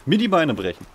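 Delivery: loud, high cry of alarm as he falls; startled yelp. Real, not acted. Gamer yelps loudly after hurting himself in a fall. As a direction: yelp it out mid-stumble.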